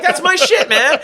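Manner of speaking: mocking high voice. Friend voiced complaining in bit.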